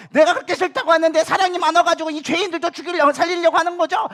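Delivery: high, loud indignant protest; Jonah. Acted. Jonah confronting God over sparing sinners, accusing and sarcastic. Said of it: "사실 하나님 앞에 따지고 있는 거예요".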